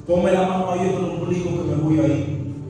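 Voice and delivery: lightly voiced demon taunting; mocking demon. Demon mocks pastor trying to cast him out, mild taunt. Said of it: "El demonio burlándose del pastor".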